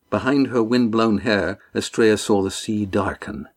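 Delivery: ordinary delivery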